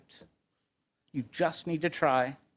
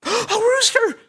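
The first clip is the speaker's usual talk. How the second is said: gasp, then high loud squeal; squealing lamb; 7 dB louder, slower than usual. A narrator's reading. Lamb hears there is a rooster, high excited squeal. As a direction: squeal it in delighted amazement. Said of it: "squealed the lamb".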